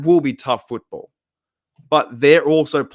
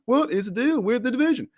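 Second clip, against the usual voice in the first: high, exaggerated observational-comic voice; squeaky parody of a stand-up catchphrase; Jerry Seinfeld; 11 semitones higher than usual. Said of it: "trying to do my Jerry Seinfeld impression".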